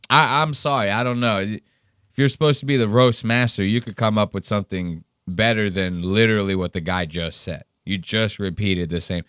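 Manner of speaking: ordinary talk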